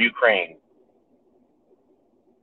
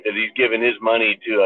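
usual speaking voice

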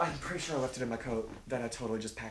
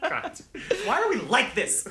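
exasperated; 12 semitones higher, 4 dB louder, slower than usual. Retold or imitated. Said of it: "I'm like".

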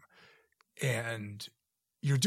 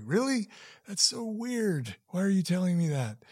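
incredulous; 8 semitones higher, 3 dB louder than usual. Retold or imitated. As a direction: act baffled and amused, pitched way up. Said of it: "I was just so like".

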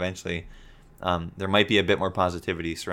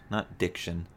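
normal talking voice

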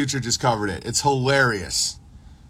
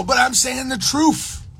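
mock whiny protest; 10 semitones higher than usual. Friend's whiny excuse acted out.

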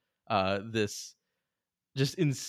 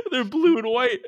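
laughing; 5 dB louder than usual. Real, not acted. Laughing at the kits.

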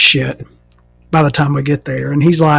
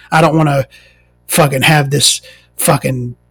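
ordinary talk, nothing marked

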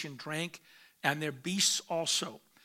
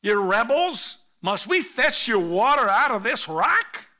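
loud, biting sarcasm; mock them with scorn; sarcastic scolding; 5 semitones higher, 10 dB louder than usual. Moses taunting the rebels at the rock, sarcastic. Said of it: "It's kind of sarcasm".

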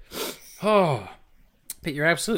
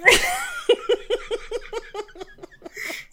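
high shouted laugh; shrieking laugh. Real, not acted.

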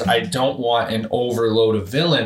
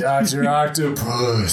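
teasing drawl; 3 semitones higher, slower than usual. Pretend.

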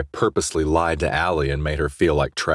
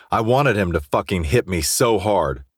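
usual speaking voice

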